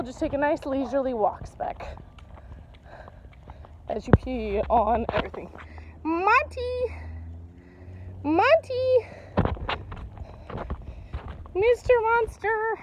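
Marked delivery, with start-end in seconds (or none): 6.0-12.8 higher calls after the dog; calling out. Real, not acted. Owner calling her runaway dog, higher calls. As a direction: call the dog back from afar.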